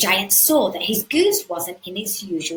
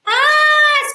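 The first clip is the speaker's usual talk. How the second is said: high, loud panicked scream; 11 dB louder than usual. Acted. Jack caught by the giant, high panicked scream. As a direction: shriek it in terror. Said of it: "screamed Jack".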